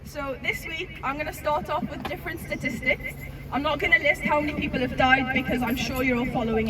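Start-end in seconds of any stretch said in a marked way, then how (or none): 0.0-3.1 raspy, fading voice; hoarse voice. Real, not acted. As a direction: voice almost gone, pushing through. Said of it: "Sorry, I'm losing my voice".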